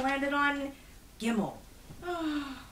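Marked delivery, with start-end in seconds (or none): 2.0-2.6 mild sigh. A narrator's reading. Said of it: "Herschel sighed".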